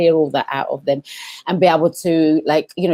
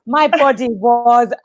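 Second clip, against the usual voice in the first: higher, slow my body was on point; wistful admiration; 5 semitones higher, 4 dB louder, much slower than usual. Retold or imitated. Recalling her younger body to herself, slow and admiring. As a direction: savour the memory slowly. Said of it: "I said to myself".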